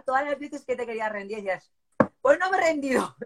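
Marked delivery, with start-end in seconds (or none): 2.2-3.3 triumphant. Retold or imitated. Quoting herself triumphant.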